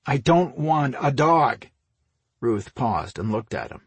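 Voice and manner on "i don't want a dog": loud, slow, raised protest; exasperated refusal. A narrator's reading. Brother refusing the gift puppy, exasperated. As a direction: stress each word in exasperation.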